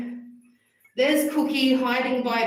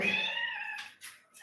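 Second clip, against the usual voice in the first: squeaky high voice; 11 dB quieter than usual. Acted. Cooing at washed cat.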